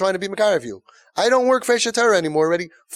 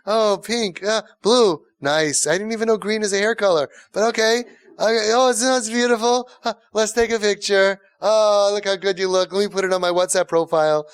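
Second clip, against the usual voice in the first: higher, louder gushing with forced praise; fake enthusiasm; 5 semitones higher, 4 dB louder than usual. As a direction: gush over the haircut like a parent faking approval. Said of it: "the fake smile".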